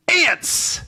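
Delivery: sudden high shocked cry; dramatic shout. Acted. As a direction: blurt it out in alarm. Dramatic reading climax.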